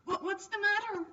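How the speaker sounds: a sudden startled what's the matter; surprised. Acted. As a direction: jump up in surprise at the silence. Startled at hesitation.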